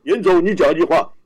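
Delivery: overdone chairman at a meeting; put-on chairman voice. Shown as a demonstration. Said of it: "不要董事长". (刻意变声的董事长腔)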